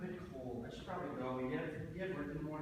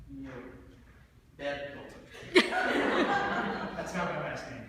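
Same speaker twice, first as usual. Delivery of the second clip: louder, slow, laughing correction; exasperated laugh; 3 semitones lower, 8 dB louder, much slower than usual. Acted. [name] to Chris, who got his name wrong, exasperated laugh.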